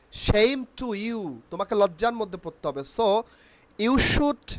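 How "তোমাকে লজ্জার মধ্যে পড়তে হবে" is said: ordinary delivery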